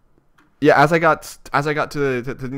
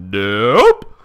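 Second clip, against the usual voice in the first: excited exclamation; 2 semitones lower, 10 dB louder than usual. Real, not acted. Hoping to finish both exams.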